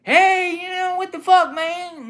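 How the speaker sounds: very high, loud mock protest; hammed-up, high-pitched hollering; imitated complaining manager. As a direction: squawk it as an exaggerated outraged boss. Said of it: "was like".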